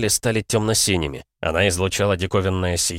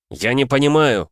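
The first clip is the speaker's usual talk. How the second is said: louder and higher frustrated cry; shout; 6 semitones higher, 5 dB louder than usual. A narrator's reading. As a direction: shout out in confusion. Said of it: "крикнул Джоран". (громкий крик непонимания)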